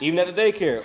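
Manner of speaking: ordinary talk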